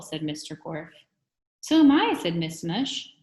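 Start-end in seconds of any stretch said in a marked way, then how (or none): 1.6-2.1 high, loud and delighted; eager exclamation. A narrator's reading. Visitor tells the teacher she's single too, high excited cry.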